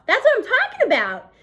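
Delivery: high, loud and pleased; boy cheering his teacher on; satisfied approval. Acted. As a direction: crow it, delighted to win. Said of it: "he approves in a satisfied tone".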